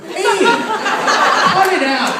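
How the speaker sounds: high, squealing mock victim; whiny victim. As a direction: yelp like someone hit by a pellet. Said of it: "it would have been like".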